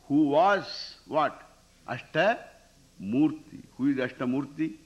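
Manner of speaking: ordinary talk, nothing marked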